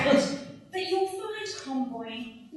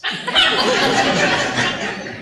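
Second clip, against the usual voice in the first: loud laugh; 7 semitones higher, 7 dB louder than usual. Acted. Laughs at her own joke.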